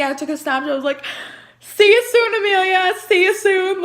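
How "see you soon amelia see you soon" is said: tearful goodbye. Real, not acted. Mother filming a Snapchat for her baby, crying high and loud. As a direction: voice cracking with tears. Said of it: "I was crying".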